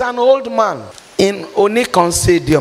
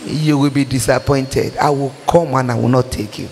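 lower, measured divine warning; voice of the Holy Spirit; 7 semitones lower than usual. Retold or imitated. Preacher voices the Holy Spirit warning her, lower and grave. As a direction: speak it as God's stern correction. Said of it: "the Holy Spirit tell me".

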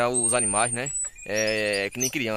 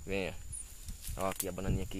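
soft coaxing call; 3 semitones lower, 8 dB quieter than usual. Real, not acted. Coaxing the monkeys to come eat.

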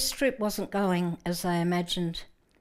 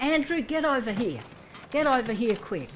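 loud, higher voice barking orders over a storm; shouted orders; 4 semitones higher, 4 dB louder than usual. Retold or imitated. Peter yelling to Andrew over the storm, loud orders. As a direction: call out like a skipper in a gale. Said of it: "He probably said things like".

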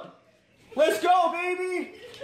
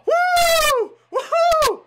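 cheering; 12 semitones higher, 3 dB louder than usual. Real, not acted. Cheering the title.

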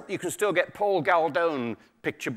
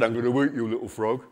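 lower, lightly voiced shark; 4 semitones lower, faster than usual. Shark to a little frog, lightly lowered creature voice. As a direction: drop down for the predator's threat. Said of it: "a big shark came up".

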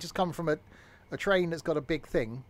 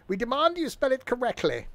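high-pitched protester making a demand; hammed-up high demand; mock indignant complainer; 10 semitones higher, 3 dB louder than usual. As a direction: act out an outraged complaint, pitched right up. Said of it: "Someone should go and complain".

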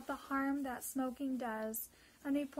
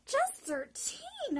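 high, loud astonished cry; shocked disbelief, slowly; exclaimed; 8 dB louder, slower than usual. Retold or imitated. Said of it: "I exclaimed".

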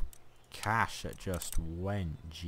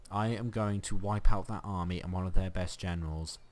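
ordinary talk

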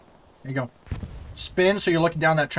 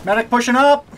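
shouting; 7 semitones higher, 6 dB louder than usual. Real, not acted. Calling out in battle.

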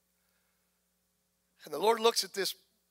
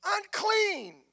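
retold yell; 10 semitones higher, much slower than usual. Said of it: "He was tired of yelling".

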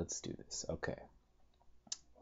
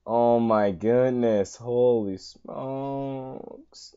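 ordinary talk

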